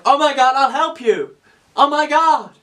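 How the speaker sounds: high, frantic would-be rescuer; over-dramatic shout to a friend; mock panic. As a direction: cry out in hammed-up alarm.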